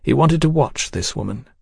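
ordinary delivery

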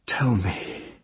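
soft, murmured request. Acted. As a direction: murmur it gently to the child. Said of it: "the woman murmured".

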